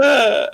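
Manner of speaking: high, loud mock "ah" scream; imitated yell. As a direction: act out the film character's yell. Imitates film character yelling.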